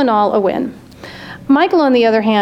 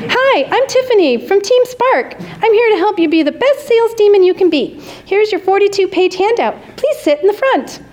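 much higher, bright perky cheerleader; perky bubbly voice; 9 semitones higher than usual. A narrator's reading. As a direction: chirp it like an overeager trainer. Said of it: "her exclamation points".

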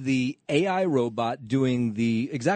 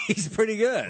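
laughing; 10 semitones higher than usual. Real, not acted. Laughs at the AI newscaster.

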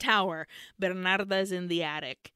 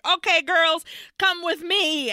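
put-on raised, higher urgent call to the girls; rally the girls with a raised, higher voice; 9 semitones higher, 6 dB louder than usual. Lucia calling the girls to follow her, raised and urgent. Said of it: "Lucia's like".

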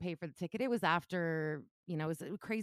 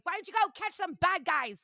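higher, louder sarcastic jab; mocking jab; 11 semitones higher, 5 dB louder than usual. Telling the cop off at the end, higher and louder. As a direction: snipe at him to leave her alone. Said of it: "like, pissed".

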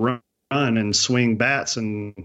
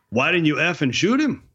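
put-on higher-pitched Canadian bush accent; backcountry Canadian accent; 6 semitones higher than usual. Owner questions hunter about missed shot, backcountry Canadian accent. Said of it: "you're doing the accent".

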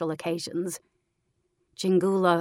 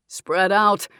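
a clipped, louder command from the sergeant; sergeant's order; 3 semitones higher, 7 dB louder than usual. A narrator's reading. Sergeant orders his soldiers to search, loud command. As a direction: give the order to the squad. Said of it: "ordered a deep voice".

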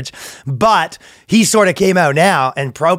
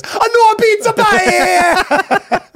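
much higher, very slow hammed-up Italian accent; mock Italian guy; 3 dB louder, much slower than usual. Italian translator joking about closed restaurants, in a cartoon Italian accent. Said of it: "the Italian guy goes".

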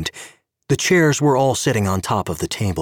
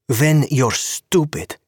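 higher, slow, contemptuous jab; scornful insult; 7 semitones higher, slower than usual. A narrator's reading. Tanya insults Gabe in the bar: slow scornful jab.

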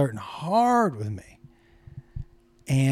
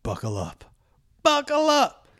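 ominous buckle up; 11 semitones higher than usual. Retold or imitated. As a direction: hype it up, rising. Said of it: "he said".

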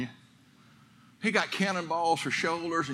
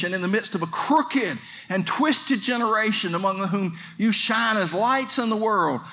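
higher and louder, driving sermon voice; full-force preaching; 9 semitones higher, 5 dB louder than usual. Acted. Preacher urging his congregation, fiery and loud.

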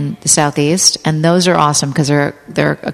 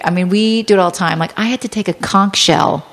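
normal talking voice

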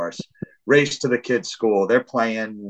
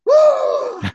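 very high, loud scream; imitated scream; 9 dB louder than usual. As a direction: shriek along in confusion. Daughter screams too.

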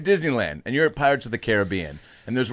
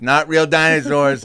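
a grandma insisting slowly the dinosaurs are fake; slow, emphatic grandma; 4 semitones higher, 7 dB louder, slower than usual. Grandson retelling grandma doubling down, slow and louder. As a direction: lean on real, drawn out and louder. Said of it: "She goes".

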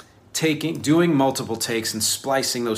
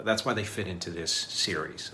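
ordinary talk

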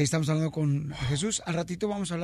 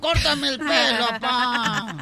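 voz aguda y lenta de niño suplicando; niño insistente; 8 semitones higher, 6 dB louder, much slower than usual. Acted. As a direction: como un niño chiquito que ruega a su papá. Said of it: "Llega un niño de cinco años". (niño de cinco años rogando, voz aguda)

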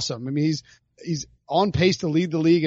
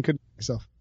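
usual speaking voice

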